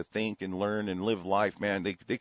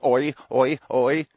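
loud, higher mocking oi oi oi; loud higher jeering chant of oi; mock oi cry; 4 semitones higher, 7 dB louder, slower than usual. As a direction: yell the oi like a taunt. Mocking cry, loud odd timbre.

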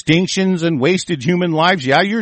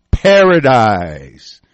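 sarcastic drawl; 4 semitones lower, 5 dB louder, much slower than usual. Acted. Sneering at promised paradise.

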